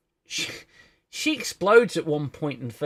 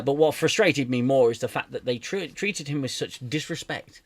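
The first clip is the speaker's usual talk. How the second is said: usual speaking voice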